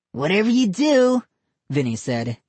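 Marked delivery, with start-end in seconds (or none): 0.1-1.2 put-on high sly boy; sly kid voice. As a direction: set up the joke slyly. Boy character voice.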